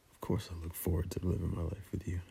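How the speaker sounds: breathy intimate whisper, very soft; sensual whisper. Acted. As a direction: whisper it close to the ear.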